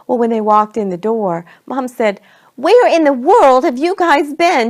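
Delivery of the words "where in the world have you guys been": high, loud exasperated scolding; mother's loud, high-pitched reproach; scolding mom. A narrator's reading. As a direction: tell off the latecomers in a raised voice. Mom scolds the late family.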